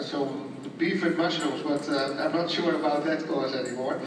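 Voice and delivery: normal talking voice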